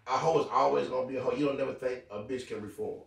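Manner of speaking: ordinary talk, nothing marked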